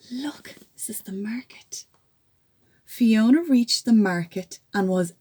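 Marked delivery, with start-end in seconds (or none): 0.1-1.8 whisper. Real, not acted. Aside to child over book.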